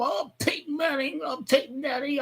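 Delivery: high whiny mock sob over the name; mocking, fake weepy lament; mock crying fans. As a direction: whimper it like a sore fan. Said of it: "So it was all".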